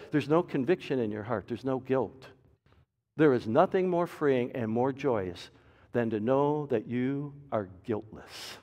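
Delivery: ordinary talk, nothing marked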